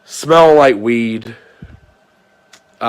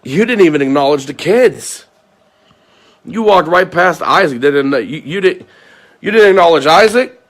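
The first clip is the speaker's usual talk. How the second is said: rising indignation; 5 semitones higher, 5 dB louder than usual. Real, not acted. Accusing her of ignoring kids.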